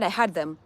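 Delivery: "heard" said like "had"; Kenyan pronunciation. Shown as a demonstration. Telling an American, "heard" mistaken for "had". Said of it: "they understand H A D".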